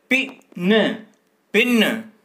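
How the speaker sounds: पिन called out high and loud, said twice; sounded out for pupils. Shown as a demonstration. Teacher to young pupils, calling out पिन loudly. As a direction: call out पिन clearly, like a teacher drilling a class. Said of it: "इन दोनों को मिलाएंगे तो क्या शब्द बनेगा?".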